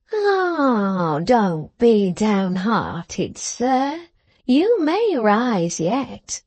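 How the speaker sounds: put-on slow, swooping old man's encouragement; consoling old grandfather. Old man telling the trooper not to be downhearted, slow and coaxing. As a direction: coax very slowly with a lilting old voice.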